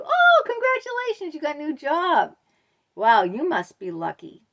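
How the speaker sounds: high, loud, gushing mock congratulations; a syrupy put-on voice with a sneer; backhanded compliment. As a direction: gush over it to belittle them. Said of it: "it's a backhanded compliment".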